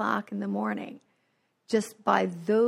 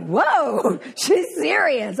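mock amazed friends; 4 semitones higher, 5 dB louder, much slower than usual. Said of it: "they were like".

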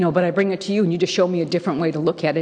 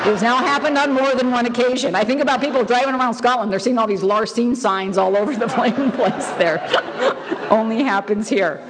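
amused laughing; 5 semitones higher than usual. Real, not acted. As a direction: let the laughter bubble through. Amused at friends' Larcene photos.

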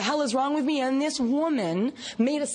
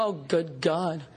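unimpressed sponsor; 8 semitones lower, slower than usual. Retold or imitated. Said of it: "She took a look at me and go".

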